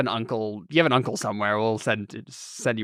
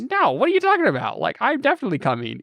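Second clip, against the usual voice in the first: insistent protest; 9 semitones higher than usual. Retold or imitated. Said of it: "Philip's gonna be like".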